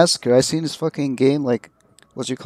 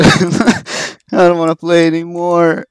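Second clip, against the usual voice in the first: nervous laugh; 5 semitones higher, 8 dB louder, slower than usual. Real, not acted. After the chase, laughing.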